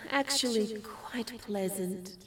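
soft, slow sigh of pleasure; quiet contentment. Acted. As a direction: murmur it slowly to yourself, savouring a hug. Savouring her first human hug.

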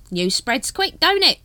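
high, loud cockney-ish hawker; street hawker voice. Acted. Dibbler greets the Captain, high hawker voice. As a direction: sidle up like a spiv.

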